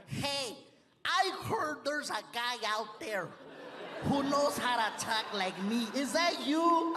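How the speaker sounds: lightly voiced comedian impression, slow; Paul Rodriguez. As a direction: imitate a fellow comic asking, rising at the end. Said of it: "He was like".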